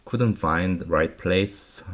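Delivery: ordinary delivery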